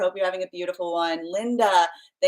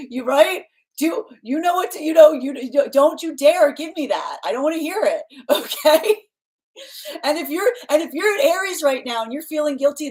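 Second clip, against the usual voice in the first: put-on mother telling squabbling kids I don't care who started it; stern cosmic mother; 8 semitones higher, 4 dB louder than usual. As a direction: scold like a mother who won't hear excuses. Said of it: "I'm gonna be your cosmic mother".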